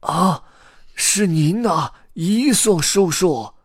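high, loud, drawn-out astonished cry; old man's high startled shout. A narrator's reading. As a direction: exclaim in amazement, stretching the words. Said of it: "故事爷爷喊道".

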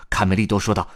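plain reading voice

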